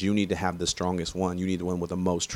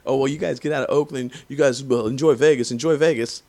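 mocking taunt; 6 semitones higher, 5 dB louder than usual. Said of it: "They keep saying".

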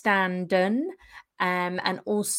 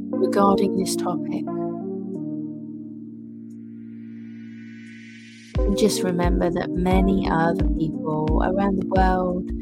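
guided meditation. Acted. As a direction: ease the listener into relaxing. Guided meditation over music.

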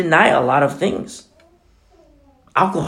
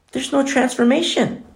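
ordinary delivery

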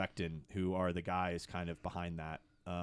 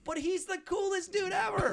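a much higher, louder burst of delight; excited shout; 3 dB louder than usual. Real, not acted. Praising Sandler to the host, excited high shout. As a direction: cry it out, thrilled. Gushing excitedly about his hero.

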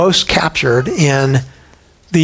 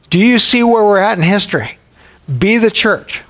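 raised, driving preacher's exhortation; rally the congregation, pitch up high; a preacher stirring his church to go out; preaching at full force; 5 semitones higher than usual. Acted.

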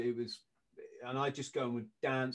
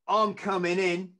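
put-on loud, raised threat in a rough Kiwi accent; bad attempt at a menacing Kiwi; crap Kiwi accent; 5 semitones higher, 6 dB louder than usual. Owner threatening the doorman, crap Kiwi accent. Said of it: "I'm trying to do the crap accent".

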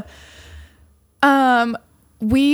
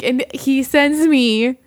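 ordinary delivery

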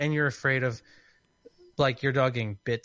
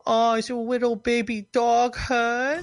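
put-on high, slow cooing baby talk; mocking baby talk at an upset dog owner; 11 semitones higher, 4 dB louder than usual. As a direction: coo it mockingly like talking to an infant. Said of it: "I started doing a baby voice".

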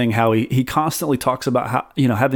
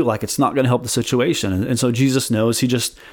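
ordinary talk, nothing marked

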